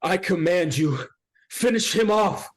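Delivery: high, loud commanding cry; commanding shout. Acted. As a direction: order the devil like a queen. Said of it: "Fiona looks up to it and says".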